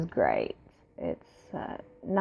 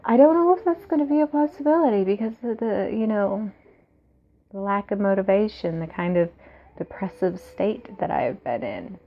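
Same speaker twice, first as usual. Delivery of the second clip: ordinary talk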